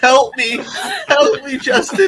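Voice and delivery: high, loud, laughing plea; mock cry for help. Calling Justin to save him from the feet, mock-desperate plea. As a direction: playfully call out for rescue.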